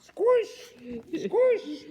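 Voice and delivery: high loud squish noises; high, loud cartoon squish; squish sound. A narrator's reading. Sound effect of being sat on.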